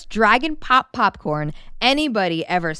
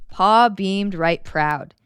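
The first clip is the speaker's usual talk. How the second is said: ordinary delivery, nothing marked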